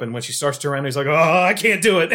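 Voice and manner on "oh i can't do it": high, loud little wail of giving up; Toby losing his nerve. Retold or imitated. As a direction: squeak out the surrender.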